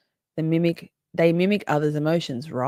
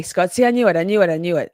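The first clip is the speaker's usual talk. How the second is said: ordinary talk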